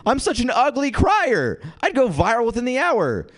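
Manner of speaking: high-pitched hammed-up wail; mock distraught. Comic dreads crying on camera, mock distraught. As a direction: lament it high, in comic panic.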